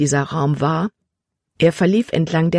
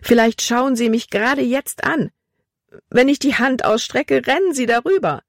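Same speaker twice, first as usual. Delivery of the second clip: high-pitched, panicky imagining; panicked; 7 semitones higher than usual. A narrator's reading. Girl imagines mice watching her in the dark, panicked. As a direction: let panic lift the voice high. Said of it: "Libby wurde von Panik überwältigt".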